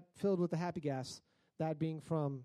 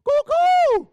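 very high, very loud giddy squeal; drugged patient cheering at the lobby; loopy cheer; 13 semitones higher, 17 dB louder, much slower than usual. Retold or imitated. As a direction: whoop like you're high on gas. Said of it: "I was just like".

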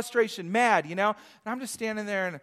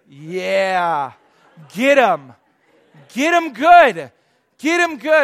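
acted egging-on; 13 dB louder, much slower than usual. Pastor urging baristas to kill with kindness, egging them on. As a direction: egg them on, each phrase stronger.

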